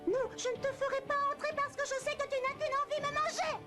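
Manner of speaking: high-pitched, agitated little pig; frightened refusal. Acted. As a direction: refuse in theatrical alarm. Pig refuses the threatening wolf.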